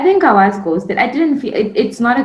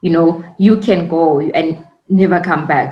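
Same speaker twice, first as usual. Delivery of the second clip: usual speaking voice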